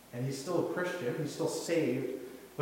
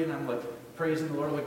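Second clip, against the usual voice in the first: ordinary talk, nothing marked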